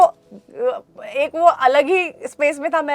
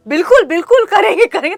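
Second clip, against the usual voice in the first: laughing eager yes; 7 semitones higher, 11 dB louder, faster than usual. Retold or imitated.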